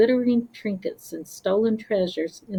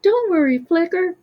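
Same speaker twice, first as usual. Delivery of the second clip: high, loud soothing call; reassuring; 9 semitones higher, 6 dB louder than usual. Acted. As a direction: comfort a scared friend. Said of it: "Slothling reassured him".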